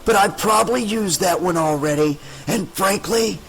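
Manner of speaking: a smug ghoul savoring the joke; rasping monster. A narrator's reading. Scarred man mocking Tricia in aisle four, raspy and high. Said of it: "he rasped in that deep, oozing voice".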